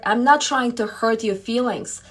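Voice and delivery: ordinary talk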